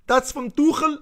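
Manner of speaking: high-pitched, badly faked German accent; hammed-up German accent; horrible German accent. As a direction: put on a clumsy German accent, pitched right up. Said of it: "Horrible, mon accent".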